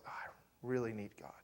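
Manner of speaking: ordinary delivery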